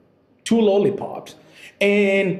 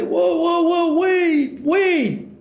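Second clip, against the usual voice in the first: much higher, flustered whoa; stunned stutter; 11 semitones higher, much slower than usual. Retold or imitated. Caught off guard as the kid curses at dad, startled. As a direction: blurt it out startled and stammering. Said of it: "I stutter and I say".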